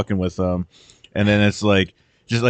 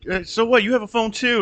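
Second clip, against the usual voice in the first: dismissive retort; 13 semitones higher than usual. Retold or imitated. Retorting in the retold scene.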